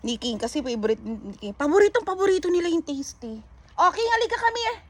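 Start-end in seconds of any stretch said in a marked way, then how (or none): 3.8-4.8 high sing-song call to a pet; calling the dog. Real, not acted. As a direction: coax the dog over, up high. (mataas na tawag sa aso)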